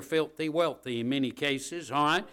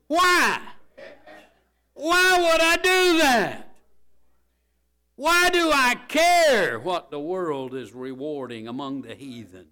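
high, loud, slow fiery preaching; preaching at full force; 7 semitones higher, 11 dB louder, slower than usual. Acted. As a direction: cry out the question in scorn.

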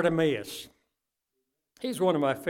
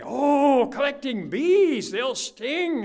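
higher, louder mock alarm; imitating excited kids; 9 semitones higher, 7 dB louder, much slower than usual. Preacher voicing the kids' alarm, higher mock excitement. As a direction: play the kids squealing about stings.

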